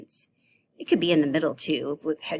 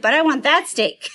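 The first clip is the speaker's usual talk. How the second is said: higher, louder playful whine; whiny demand; 9 semitones higher, 8 dB louder than usual. Acted. Child at the dinner table whining to Mom, louder. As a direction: beg for that steak like a kid. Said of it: "You're like".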